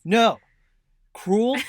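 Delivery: loud, high gasp of outrage; horrified no; shocked outrage. Real, not acted. Reacting to cats chased.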